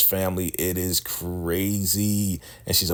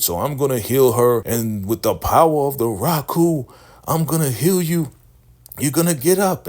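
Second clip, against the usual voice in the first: preaching cult leader; 3 semitones higher than usual. Retold or imitated. Cult leader commanding the wounded woman to rise, booming preacher. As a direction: call down power like a faith healer.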